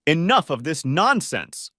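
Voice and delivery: understated yell ending the quarrel; mild yell. A narrator's reading. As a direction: put your foot down, slowly. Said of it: "I yelled at both of them".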